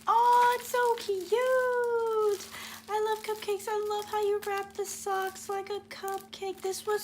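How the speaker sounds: high, loud squeal of delight, I love cupcakes gushed; excited high gushing. Real, not acted. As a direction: squeal as you see the surprise. Unwraps the cupcake socks.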